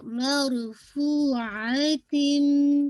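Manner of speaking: मरफूअतिन very slow, ending in a long hum; full recitation. Shown as a demonstration. Teacher models the whole word, slow recitation. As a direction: stretch every syllable of मरफूअतिन. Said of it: "तिन पूरा आपका".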